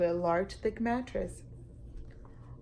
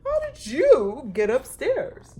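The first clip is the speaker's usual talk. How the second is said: booming watchman; 5 semitones higher, 9 dB louder, slower than usual. Acted. Watchman questions bear.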